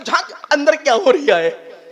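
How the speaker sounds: loud, high-pitched nosy questioning; hammed-up curiosity. Preacher imitating old folk at a forbidden curtain, loudly curious. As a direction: play an onlooker itching to peek.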